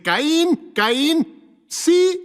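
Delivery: lightly voiced God calling Cain by name.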